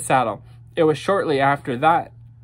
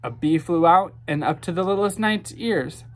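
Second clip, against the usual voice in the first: ordinary delivery, nothing marked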